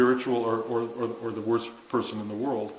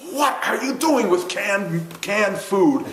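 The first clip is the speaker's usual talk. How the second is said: loud, high, drawn-out reproach; mock scolding; 8 semitones higher, 5 dB louder, slower than usual. Teacher catches a student adding canned food, mock scolding. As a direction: imitate an indignant teacher telling someone off. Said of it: "He says".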